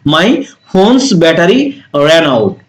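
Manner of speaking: slow, careful English phrase; slow model sentence. Shown as a demonstration. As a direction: spell it out slowly for learners. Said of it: "run not ran". (ধীরে ধীরে স্পষ্ট উচ্চারণ)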